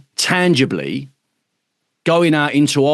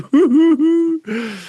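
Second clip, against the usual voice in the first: high burst of laughter. Real, not acted. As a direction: laugh hard at the joke. Laughing at own impression.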